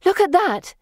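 high, loud excited shout. A narrator's reading. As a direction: call out, pointing at something. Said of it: "Felicity gives a shout".

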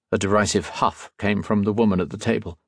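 ordinary delivery, nothing marked

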